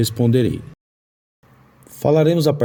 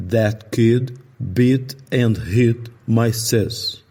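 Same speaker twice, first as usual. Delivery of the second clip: said slowly, each word set apart; slow example sentence; much slower than usual. Shown as a demonstration. Teacher reads the short vowel sentence slowly. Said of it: "a frase é".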